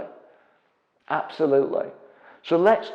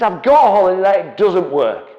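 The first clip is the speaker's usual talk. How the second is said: loud, raised mock complaint; furious customer; 5 semitones higher, 10 dB louder than usual. Angry client complaining about his holiday let, loud mock fury. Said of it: "He's furious".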